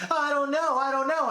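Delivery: high-pitched mock protest; Giselle. Cornered friend denies knowing, high mock protest. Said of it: "And Giselle's like".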